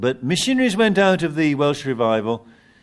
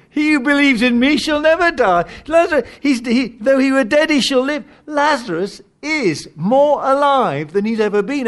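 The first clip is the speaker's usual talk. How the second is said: high, ringing proclamation; preaching at full force; 11 semitones higher, 4 dB louder than usual. Acted. Preacher proclaiming Jesus' words to the church, ringing. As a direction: lift it up and proclaim.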